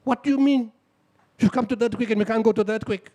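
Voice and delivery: incredulous teams. Retold or imitated. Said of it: "The teams asked me".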